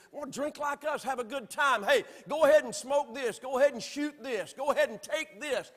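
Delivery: a tempting party friend, egging on; mock peer pressure. The world urging young people to use. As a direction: coax them to try it, lightly hammed.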